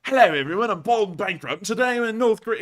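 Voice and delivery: loud, high, put-on travel vlogger greeting; mock vlogger intro. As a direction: ham up a cheery YouTuber opening.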